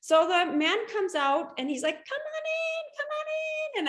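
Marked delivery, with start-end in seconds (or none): 2.0-3.9 put-on a light Spanish accent, high and softly inviting; shopkeeper's accent. Shoe seller welcoming her into the boutique, light Spanish accent. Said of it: "with his nice accent and everything".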